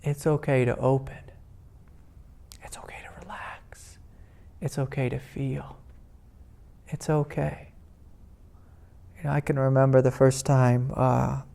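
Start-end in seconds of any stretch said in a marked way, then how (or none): 0.0-7.7 soft, soothing reassurance, dropping to a whisper on relax; softly tells his own body it may open; gentle reassurance. Shown as a demonstration. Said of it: "just gently remind it".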